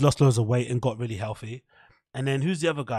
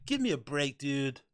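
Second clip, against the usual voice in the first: normal talking voice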